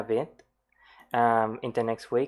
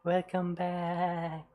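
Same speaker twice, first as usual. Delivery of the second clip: much higher, very slow welcome back; imitating Kiara; 7 semitones higher, 4 dB quieter, much slower than usual. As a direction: stretch it out in head voice. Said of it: "she does it with a much more head resonance".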